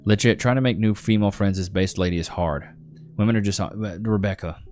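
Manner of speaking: ordinary delivery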